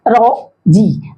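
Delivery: slow "रो", pause, "जी"; measured two-beat reading of रो-जी; syllable by syllable. Shown as a demonstration. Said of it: "ये कौन syllabic word है".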